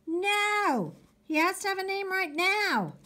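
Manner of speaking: put-on high-pitched kid outcry; boys shouting. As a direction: shout it like insistent little boys. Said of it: "The boys shouted in unison".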